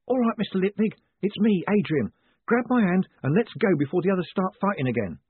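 usual speaking voice

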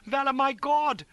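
very high, loud, drawn-out scream; horrified scream. A narrator's reading. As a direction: scream her name in horror. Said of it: "he positively screamed".

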